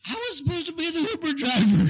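high-pitched mock drunk-girl whine. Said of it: "the drunkest one's like".

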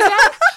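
high loud burst of laughter. Real, not acted. Remembering the man running away, loud laugh.

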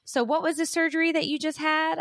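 imitated breezy question, held high; high-pitched put-on casual curiosity; mock nonchalant. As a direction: mimic a commenter making innocent small talk. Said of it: "You nonchalantly pop up back".

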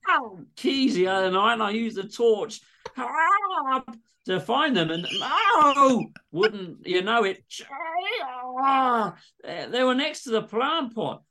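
high hammed-up yelps in Aussie; Aussie patient yelping while plunged; pained cries.

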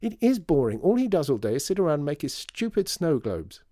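put-on loud, high-pitched childish rant; angry complaint. A narrator's reading. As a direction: complain hotly and loudly. Child rants about her father.

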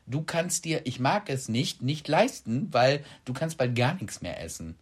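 ordinary delivery

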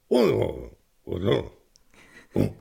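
low wordless Pict "oh"s, spaced out; imitated Pict noises. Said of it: "and then they go".

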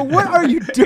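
high, laughing shock; laughing at his friend in a Pacers shirt; laughing disbelief. Retold or imitated. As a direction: crack up in disbelief. Shocked at friend switching sides.

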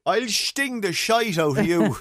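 put-on slow, high Mayo accent threat. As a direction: drag out a broad Mayo threat. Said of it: "you need to do it with a Mayo accent".

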